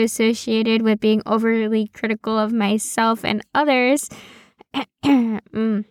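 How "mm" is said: throat clear, then a small "mm"; pointed throat clearing. Acted. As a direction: clear your throat knowingly at your own admission. Knowing aside after self-critical admission.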